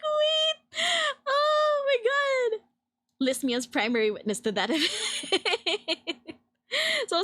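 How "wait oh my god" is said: much higher shriek with a crying sound; overwhelmed shriek. Retold or imitated. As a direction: cry out, slow and overwhelmed. Retold overwhelmed fangirl reaction.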